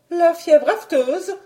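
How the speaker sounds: high, slow, incredulous query; high-pitched protesting baroness; balking. Acted. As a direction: balk at the excuse in disbelief. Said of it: "regimbait la baronne".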